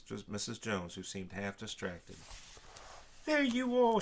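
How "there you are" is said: much higher, much louder relieved cry; frantic mother spotting her daughter, screaming. A narrator's reading. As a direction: shriek it across the platform. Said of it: "she screamed".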